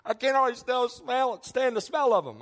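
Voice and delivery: imitated wife, high and insistent; repulsed pregnant wife. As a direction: mimic a wife who can't bear it. Retold pregnant wife's revulsion at smell.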